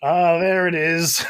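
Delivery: loud, drawn-out mock aha; viewer's knowing recognition. Viewer catching an actor's accent slip, drawn-out mock aha. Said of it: "And you're like".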